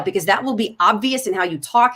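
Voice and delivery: ordinary talk, nothing marked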